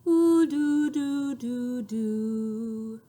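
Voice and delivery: mournful falling doos; sad voice. Shown as a demonstration. Showing a sad voice to the children. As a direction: let it droop sadly. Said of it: "sad voices".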